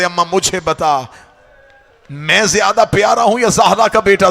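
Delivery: rising into a loud crying shout; building wail. Acted. Preacher voicing Akbar to his aunt, building to a loud wail. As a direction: let the plea break into a cry.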